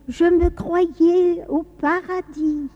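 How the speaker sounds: put-on dreamy child. Third child waking from seven years' sleep, dreamy. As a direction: draw it out like a child waking in wonder.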